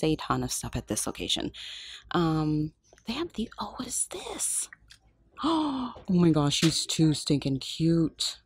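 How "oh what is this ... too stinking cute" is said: ordinary talk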